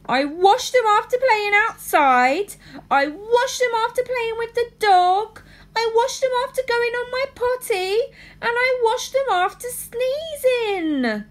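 put-on high whiny little girl; cartoon princess. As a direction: list it all in a high whine. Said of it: "said the little princess".

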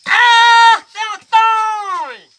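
loud, high, long-drawn holler; calling out. Acted. Digger calls back his runaway companion, hollering high and long. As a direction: holler after someone who ran off.

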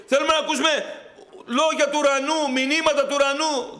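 high, loud fervent sermon cry; full-force preaching. Acted. Preacher rousing the brothers to seek God, fervent and loud. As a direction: preach it out to the whole church.

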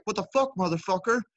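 retold trucker shouting curses; angry driver.

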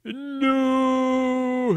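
high, loud shout of denial; Dumbledore's alarmed cry. Acted. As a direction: bellow it out in shock. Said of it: "he shouted".

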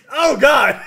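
high, loud yelp breaking into laughter; hammed-up shriek of alarm; mock panicked cry. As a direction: cry out as if a stunt just went wrong. Acting man who cuts his neck.

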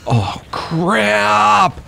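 high-pitched cry of dismay. Real, not acted. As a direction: groan upward as your plan backfires. Realises he made it worse.

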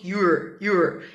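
"you're" as one low, short syllable; contracted you're. Shown as a demonstration. Teacher showing how Americans really say it, contracted. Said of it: "Eles vão falar assim".

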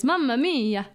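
hammed-up Italian accent; playful mock-Italian exclamation. Shown as a demonstration. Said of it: "Italian accent, just hold out your fingers".

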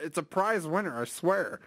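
ordinary talk

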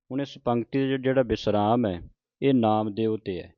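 ordinary delivery

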